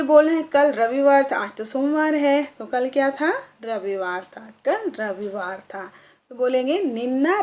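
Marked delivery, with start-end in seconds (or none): none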